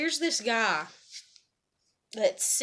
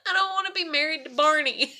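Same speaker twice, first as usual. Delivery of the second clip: laughing; 6 semitones higher, 7 dB louder than usual. Retold or imitated. Laughing while mocking husband's outfit.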